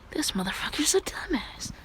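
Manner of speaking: soft voiceless whisper; hushed aside insult. Retold or imitated. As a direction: whisper it under your breath. Character's muttered aside.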